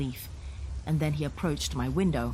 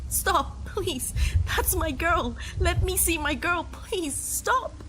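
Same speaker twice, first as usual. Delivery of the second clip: high, broken begging; father begging the officer to let him see her; desperate pleading; 14 semitones higher, slower than usual. Acted. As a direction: plead through grief. Begging to see his girl.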